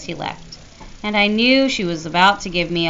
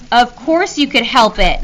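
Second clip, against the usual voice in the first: scolding; 5 semitones higher, 8 dB louder than usual. A narrator's reading. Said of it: "Mom scolded".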